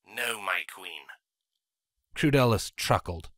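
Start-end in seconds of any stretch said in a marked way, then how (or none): 0.1-1.1 soft, lower obedient servant; quiet servant. Acted. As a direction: answer meekly, quietly.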